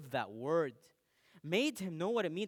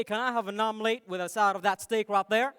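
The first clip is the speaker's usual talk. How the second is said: put-on Texan accent; 3 semitones higher, 10 dB louder than usual. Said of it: "I butchered your accent".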